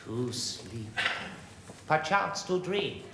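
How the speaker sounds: quiet, low stage verse; Shakespearean declaiming. Acted. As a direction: speak the soliloquy inward. Actor declaiming soliloquy.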